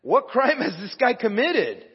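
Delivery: high, slow demanding question; Pilate demanding. A narrator's reading. Preacher voices the governor challenging the mob, demanding. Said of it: "Pilate demanded".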